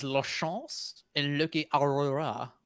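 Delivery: slow, hammy fake French accent. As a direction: say the names like a fake French announcer. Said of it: "Is, is this your fake French bit?".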